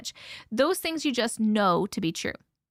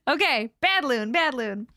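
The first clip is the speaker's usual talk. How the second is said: playful bad Loon, bad Loon; light mock telling-off; mock scolding. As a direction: scold like a pet owner, teasing. Scolding a friend jokingly.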